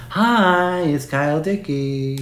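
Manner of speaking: high, drawn-out parody greeting of a hack impressionist; Kyle Dickey impression. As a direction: spoof a cringey celebrity-voice greeting. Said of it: "his worst hack impression is Kyle Dickey".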